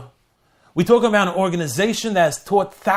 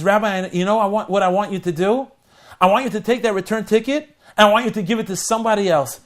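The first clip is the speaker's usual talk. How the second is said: eager request; 5 semitones higher, 6 dB louder than usual. Acted.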